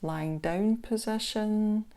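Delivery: unhurried body-scan guidance with long pauses; guided meditation. Acted. Instructor guiding listeners through a body scan meditation, calm guided meditation voice. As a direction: ease the listener into stillness, leaving space between phrases.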